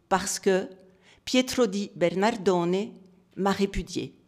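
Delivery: projected, deliberate recitation in separated phrases; solemn reading aloud. Real, not acted. Reads fresco inscription aloud. (lecture solennelle, déclamée, avec des pauses)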